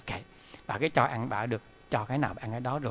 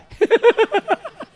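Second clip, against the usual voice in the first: bright burst of laughter; a short, loud, high laugh; 10 dB louder than usual. Real, not acted. Laughs at the outcome.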